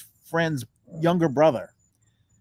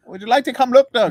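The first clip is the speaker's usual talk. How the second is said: higher, louder imitation of an Irish brogue; mock Irish doctor's brogue, loud and raised; thick Irish brogue; 5 semitones higher, 8 dB louder than usual. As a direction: put on a hearty Irish brogue. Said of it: "has a very thick Irish brogue".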